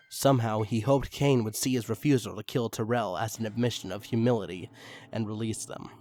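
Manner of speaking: plain reading voice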